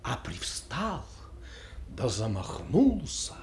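dramatic recital, swelling loud and dropping to hushed phrases; impassioned literary recitation. A narrator's reading. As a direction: recite with sweeping, changeable intensity. (вдохновенная художественная декламация с перепадами от громкого к тихому)

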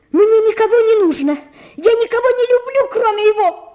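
high, loud passionate shout. A narrator's reading. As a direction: hurl it out defiantly. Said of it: "кричала Наташа".

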